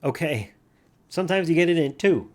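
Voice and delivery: excited win. Real, not acted. Solving the Wordle in two, loud excited cheer. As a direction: crow it out, thrilled.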